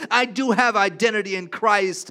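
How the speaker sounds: loud, swelling preacher's proclamation; preaching at full force. Acted. Pastor declaring God's faithfulness to the congregation, loud and fervent. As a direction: lift the room with bold declarations.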